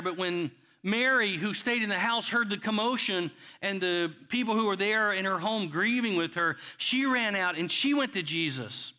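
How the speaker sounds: usual speaking voice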